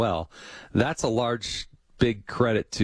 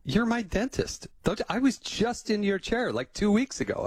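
higher-pitched, mock-indignant insistence; mildly exasperated, higher voice; mad stranger; 12 semitones higher than usual. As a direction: play the stranger sure you're his dentist. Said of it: "he get mad at me".

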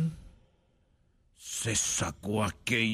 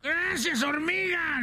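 high, drawn-out cartoon cry; grateful donkey; slower than usual. Rescued donkey thanks the ant, high mock cry.

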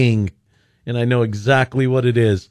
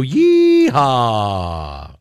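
rodeo yeehaw. Real, not acted. Radio host's rodeo call.

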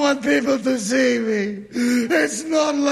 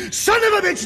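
a higher, mocking yell of the insult; retold yell; 9 semitones higher, faster than usual. Trucker mimics the road hog yelling at him, mildly. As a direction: throw the insult back like the road hog did. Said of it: "yell back".

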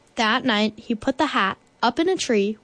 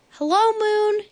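high, sustained call to the moon; storybook shout; 12 semitones higher, 3 dB louder than usual. A narrator's reading. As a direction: call up to someone far away. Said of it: "he shouted".

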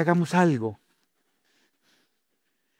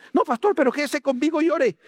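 high, whiny plea to the pastor; pleading churchgoer; 11 semitones higher than usual. Retold or imitated. Churchgoer begging the pastor to stay and pray, whiny. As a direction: beg the pastor like a suddenly devout member.

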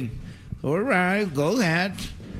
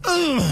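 pained groan; 4 dB louder than usual. Real, not acted. Pain after the blow.

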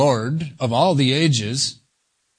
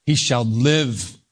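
"live" drawn out slowly with a fall; stress on LIVE; slower than usual. Shown as a demonstration. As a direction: lean long on "live". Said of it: "Emphasis on the word".

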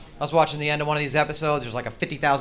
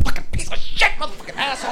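high, loud cursing scream; yelling at furniture; 12 semitones higher, 5 dB louder than usual. Retold or imitated. Comic yelling at a coffee table after stubbing his toe. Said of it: "I'm yelling like it's a person".

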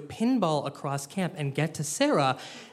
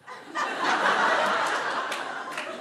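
high breathless laugh. Real, not acted. Laughing at Sarah's line.

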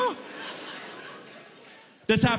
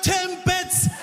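much higher, clipped and insistent with a laugh in it; impatient customer; 9 semitones higher than usual. Retold or imitated. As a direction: customer repeating the obvious word. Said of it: "She's like".

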